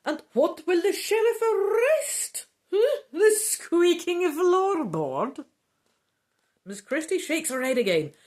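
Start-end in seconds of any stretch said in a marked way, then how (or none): 0.0-5.4 high, loud shrieking woman; outraged countess shrieks at the sheriff talk. Acted. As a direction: shriek it out, much higher and louder. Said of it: "Countess Orsini shrieks".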